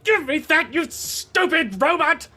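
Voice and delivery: high, loud furious demand; angry yell. Acted. Game master as a villain grabbing at a robot, angry yell. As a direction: yell it as you grab for the case.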